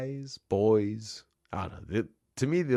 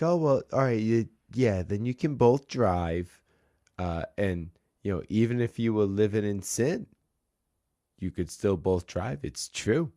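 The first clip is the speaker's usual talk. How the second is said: a hint of a chatty Boston clerk; Boston accent; 2 semitones higher than usual. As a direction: imitate the rental counter lady in a mild Boston accent. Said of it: "get back into the accent".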